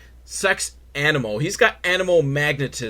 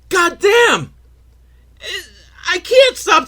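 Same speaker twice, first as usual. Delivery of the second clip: high, loud exclamation; a sudden yelp of exasperation; exasperated outburst; 6 dB louder, slower than usual. Real, not acted. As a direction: blurt it out in mock despair. Exasperated outburst about the film.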